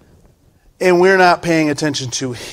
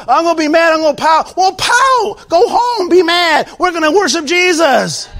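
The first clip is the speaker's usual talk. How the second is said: higher, loud sermon rebuke of grumblers with shouted peaks; fiery preaching; 6 dB louder than usual. Acted. Pastor scolding a grumbling congregation, loud fiery preaching. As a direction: preach hard at the complainers, voice lifted.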